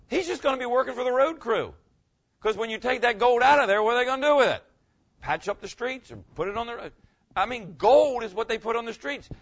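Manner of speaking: loud, high fervent preaching; preaching at full force. Acted. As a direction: raise the roof with excitement. Excited preaching builds up.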